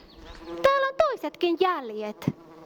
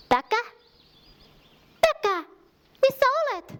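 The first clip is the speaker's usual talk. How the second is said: calling out; 6 semitones higher than usual. Acted. Shepherd calling for her lost lamb Päkä, searching. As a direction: call out searching.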